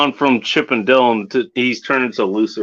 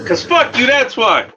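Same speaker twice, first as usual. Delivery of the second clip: excited shout; 13 semitones higher than usual. Acted. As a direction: belt the catchphrase out gleefully. Repeating catchphrase, raised pitch.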